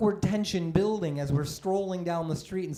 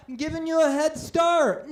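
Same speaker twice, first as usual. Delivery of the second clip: mock helpful reply; 9 semitones higher, 7 dB louder than usual. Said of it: "And I'm like".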